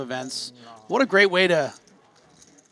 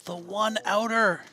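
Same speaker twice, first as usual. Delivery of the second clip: excited; 7 semitones higher, slower than usual. Real, not acted. Stunned commentator.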